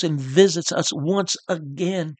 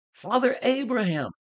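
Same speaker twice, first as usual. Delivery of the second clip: a high, yearning call to the sky; crying out to heaven; 5 semitones higher than usual. Retold or imitated. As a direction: lift the voice up toward Abraham. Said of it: "cried out".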